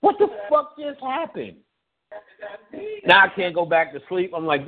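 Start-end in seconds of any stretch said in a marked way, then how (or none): 0.0-1.6 bewildered. Retold or imitated.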